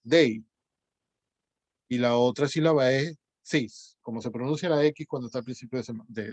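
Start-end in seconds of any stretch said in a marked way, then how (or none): 0.0-0.4 dei said as one gliding syllable; single syllable dei. Shown as a demonstration. Teacher demonstrating to class the one-syllable dei. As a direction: run the e and i together as one beat, dei. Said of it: "formar una sola sílaba".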